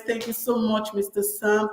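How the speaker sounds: ordinary talk, nothing marked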